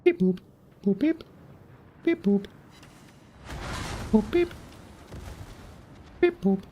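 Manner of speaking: high robotic beep boop; high chirping robot noises. Pretend. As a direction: chirp like a little machine. Imitating ship's radar beeps.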